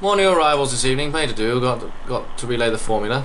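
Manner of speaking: put-on English accent. Guide addressing new arrivals, English accent. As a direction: open like a busy English host. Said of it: "I don't know why he's English".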